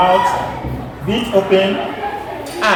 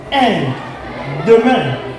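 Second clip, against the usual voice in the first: usual speaking voice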